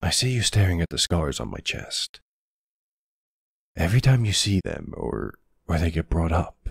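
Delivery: ordinary delivery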